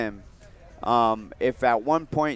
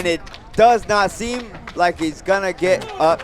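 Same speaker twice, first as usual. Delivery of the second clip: excited; 7 semitones higher, 7 dB louder than usual. Real, not acted. Knockout call live.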